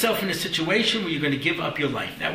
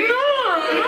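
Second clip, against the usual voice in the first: a very high, comic "No, no"; squeaky no; 4 dB louder than usual. Retold or imitated. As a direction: squeak it like a panicked bunch. Group's refusal, acted.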